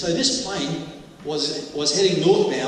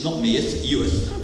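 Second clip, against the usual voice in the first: usual speaking voice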